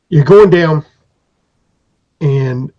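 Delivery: ordinary delivery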